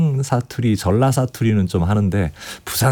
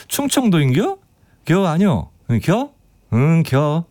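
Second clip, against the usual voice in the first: higher, teasing Chungcheong "겨" endings; 충청도 사투리로 장난스럽게; playful Chungcheong dialect; 5 semitones higher than usual. Retold or imitated. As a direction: bounce the "겨" endings in Chungcheong style. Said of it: "사투리 어설프게 써서".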